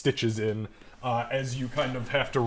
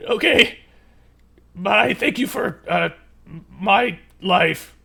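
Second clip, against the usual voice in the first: high nervous NPC voice; 10 semitones higher, 5 dB louder, slower than usual. Acted.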